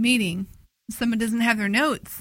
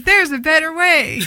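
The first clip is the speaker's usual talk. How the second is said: light mock infomercial narrator, louder, laugh creeping in; 5 semitones higher, 7 dB louder than usual. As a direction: play an ad narrator, amused. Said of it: "And the narrator says".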